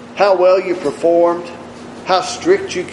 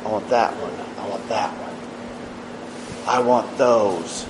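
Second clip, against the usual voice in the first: fading to a hush; 5 semitones lower, 8 dB quieter than usual. Acted. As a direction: let the claim die down to a murmur. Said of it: "he'll say".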